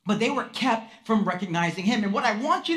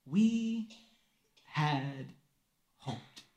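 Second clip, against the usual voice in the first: each word apart, lower and sinking to almost nothing; heavy disappointment; 8 semitones lower, 7 dB quieter, much slower than usual. Shown as a demonstration. Pastor dwelling on the disappointed disciples' words, slow sinking sorrow. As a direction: let the hope drain out word by word. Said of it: "three words that capture disappointment in a nutshell".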